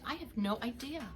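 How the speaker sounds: usual speaking voice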